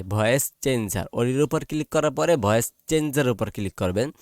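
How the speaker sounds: normal talking voice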